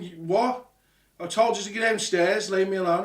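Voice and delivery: loud, high, exasperated call back; imitated angry shout. Dad in bed shouting down to son, irritated yell. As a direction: play dad hollering to be left alone. Retold shouted exchange through floors.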